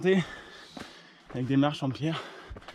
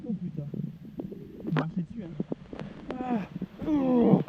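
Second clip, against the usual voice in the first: cramp pain; 4 semitones higher, 4 dB quieter than usual. Real, not acted. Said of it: "Putain de mollet".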